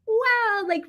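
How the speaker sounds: high bubbly "wow" like an overly happy American; mock American cheer. Shown as a demonstration. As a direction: toss off a sky-high chirpy "wow". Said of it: "really happy intonation".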